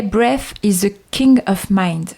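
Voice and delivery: slow, careful English with a French accent; reading an English quote in French-accented English. Shown as a demonstration. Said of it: "Désolée pour l'accent".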